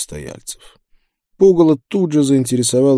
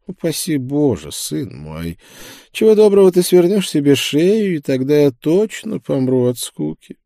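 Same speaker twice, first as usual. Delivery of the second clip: higher-pitched preacher, mock-pious; joking preacher; 6 semitones higher than usual. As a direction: tease in the preacher's raised voice. Voices the preacher in dialogue. (шутливый проповедник, высоким голосом)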